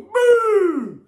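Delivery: very high, loud shouted Boom. Retold or imitated. Winding up Nat on the show, he yells his catchphrase. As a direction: bellow the catchphrase. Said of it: "I go".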